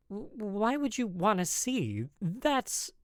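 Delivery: flustered. Acted. Flustered man asked about books.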